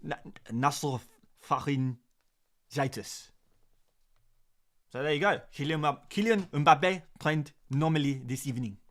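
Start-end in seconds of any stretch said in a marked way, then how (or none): 0.0-3.3 very slow, halting French accent. Shown as a demonstration. Streamer struggling with a medical word, in a French accent. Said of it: "let's do it in a French accent as well". 5.5-8.8 put-on French accent. Shown as a demonstration. Streamer showing off for the viewers, in a French accent. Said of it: "In a French accent for you guys".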